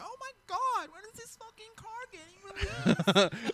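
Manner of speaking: very high, slow, gushing fan squeal. Retold or imitated. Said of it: "everyone was like".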